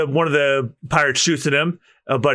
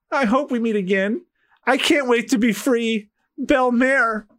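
higher, yearning character voice; wistful thief; 8 semitones higher than usual. Acted. Thief sailing off toward freedom, wistful. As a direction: voice a private hope, pitched up.

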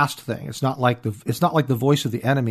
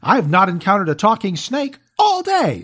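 much higher, smug, self-satisfied voice; mock smug believer; 9 semitones higher, 3 dB louder than usual. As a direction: parody someone sure they're safe.

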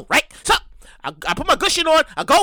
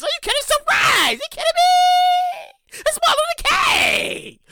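mock outrage; 13 semitones higher than usual. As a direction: shriek it like it's unthinkable. Mock outraged teacher/student exclamation.

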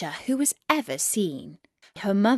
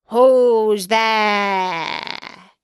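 wolf; 6 dB louder than usual. A narrator's reading. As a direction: call out as the wolf, long and loud. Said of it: "said the wolf".

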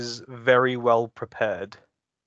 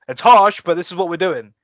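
emphatic; 3 semitones higher, 6 dB louder than usual. Real, not acted. Declaring a strict rule.

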